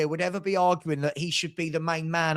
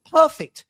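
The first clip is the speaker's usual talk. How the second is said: a burst of emphasis, higher and louder on Perfect; emphatic; 8 semitones higher, 7 dB louder than usual. Real, not acted. Fan ranting to co-host about the signing, insisting hard on Perfect. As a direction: slam the single word Perfect home, high and loud. Insisting on a squad player's worth.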